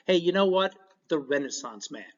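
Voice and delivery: ordinary talk, nothing marked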